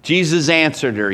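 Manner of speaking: ordinary delivery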